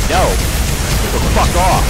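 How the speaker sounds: angry yell. Real, not acted. Telling the victim off, angry yell. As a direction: bark it right at them.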